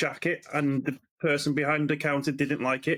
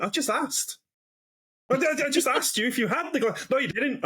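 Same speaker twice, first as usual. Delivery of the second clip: retold argument; 7 semitones higher than usual. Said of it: "I went".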